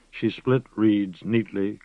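ordinary delivery, nothing marked

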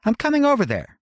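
high, bright-pitched woman's voice; Winnie's voice. A narrator's reading. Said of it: "said Winnie".

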